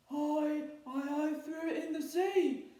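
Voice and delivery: a caught troll stumbling over words; stammering troll. A narrator's reading. Troll confessing to angry pirates, stammering. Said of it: "said the Troll".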